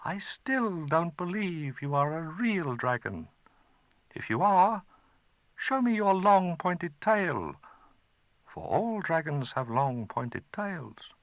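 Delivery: put-on a bold little boy taunting a dragon; boy challenging the dragon; young boy Chai.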